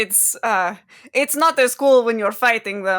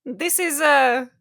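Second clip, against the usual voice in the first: happy greeting; 7 semitones higher, 4 dB louder, slower than usual. Acted. Zin greets old friend warmly.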